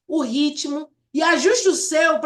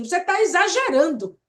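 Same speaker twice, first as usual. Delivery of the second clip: blunt accusation; 4 semitones higher than usual. Shown as a demonstration. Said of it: "em vez de você dizer assim".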